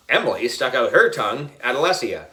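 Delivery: ordinary delivery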